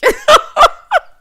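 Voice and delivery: very loud, high cackling burst; real laugh. Real, not acted. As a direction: burst out laughing at your own joke. Cracks up at own joke.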